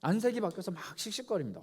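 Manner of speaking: ordinary delivery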